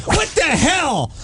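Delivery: high, drawn-out indignant cry; mad outburst. Retold or imitated. Husband mad at the friend who brings pastries, indignant. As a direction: stretch it out slowly, pitch way up. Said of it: "and then I get mad at Ozzy".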